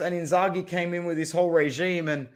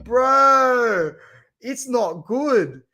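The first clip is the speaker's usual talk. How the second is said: loud anguished cry of despair; exasperated cry; 5 semitones higher, 5 dB louder, much slower than usual. Real, not acted. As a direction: let out a pained wail at the fixture list. Groaning at the bad fixtures.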